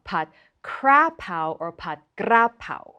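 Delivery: "Krapao" and "Grapao", R in the first syllable; misplaced R. Shown as a demonstration. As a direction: move the R forward in "Krapao", then "Grapao". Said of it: "put in the wrong place".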